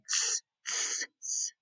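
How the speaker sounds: short voiceless tss sounds, softly; monkey hissing tss. A narrator's reading. Monkeys answer the peddler with soft hissed tss. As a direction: hiss back like the monkeys, in short bursts. Monkeys' mocking reply in story.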